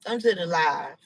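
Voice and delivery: normal talking voice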